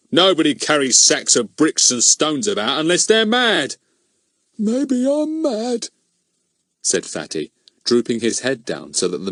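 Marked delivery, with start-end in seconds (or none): 0.1-3.8 high, loud scoffing policeman; scornful. Acted. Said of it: "said Goon in scorn". 4.6-5.9 put-on very high, slow, drawn-out tired old voice; sad weary old man. Fatty keeps old man voice.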